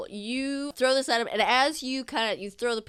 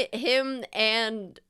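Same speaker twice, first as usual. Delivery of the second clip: ordinary talk, nothing marked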